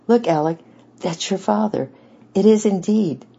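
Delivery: high, loud and tearful, close to sobbing; almost crying out with overwhelmed joy; voice breaking. Acted. Grandfather showing Alec his long-lost father, voice breaking. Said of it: "fairly broke down and sobbed like a child".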